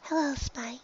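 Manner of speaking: shy pony greeting the dragon; softly. A narrator's reading. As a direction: say hello softly. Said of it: "the Pegasus said softly".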